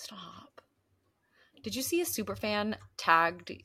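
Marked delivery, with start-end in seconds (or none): 0.0-0.6 soft playful whisper; whispered stop. Real, not acted. Telling her co-host to stop, whispered.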